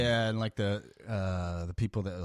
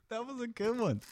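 high laughing praise. Real, not acted. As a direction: squeak it while laughing. Reacting to ASMR bit.

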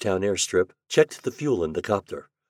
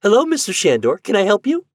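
put-on a much higher, bright, friendly young woman; young clerk girl; 13 semitones higher, 8 dB louder than usual. A narrator's reading. PIB clerk greets Shandor on video, friendly young woman. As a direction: greet him like a cheerful office clerk. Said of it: "The girl".

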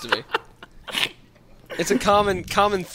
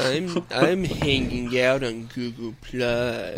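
slow cartoon hound drone; very slow hangdog impersonation; Droopy the Dog; much slower than usual. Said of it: "really solid Droopy the Dog impersonations".

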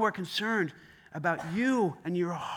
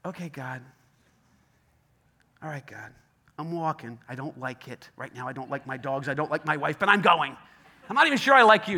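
grudging grumble; 4 semitones lower, 6 dB louder than usual. Acted. Grudging obedience to God.

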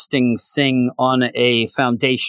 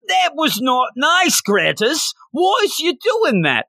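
much higher, slow, prim royal voice; Prince Charles; slower than usual. Acted. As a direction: play a huffy prince, drawn out. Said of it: "that was Prince Charles".

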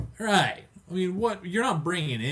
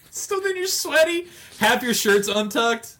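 higher, louder, amused; excited; 11 semitones higher, 6 dB louder than usual. Real, not acted. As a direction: tell it with rising glee. Excited telling.

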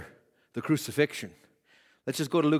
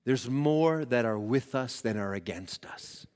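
Elijah's prayer; 3 semitones lower, 5 dB quieter than usual. Retold or imitated. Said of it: "and Elijah said".

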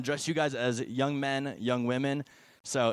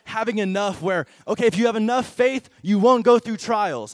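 mock lecturing; 5 semitones higher, 7 dB louder than usual. Mocking false view, louder.

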